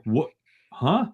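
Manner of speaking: high baffled "What? Huh?"; a puzzled judge, caught off guard; mild mock confusion from the judge; judge confused. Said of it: "the judge is like".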